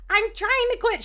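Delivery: mock plea in a high pitch; imitated pleading. Woman answering whether she smokes, high mock pleading.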